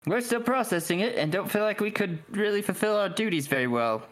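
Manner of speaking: high-voiced guard being candid; Mintarn guard. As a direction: confess it in a high character voice. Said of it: "one of the Mintarn guards just looks at you and goes".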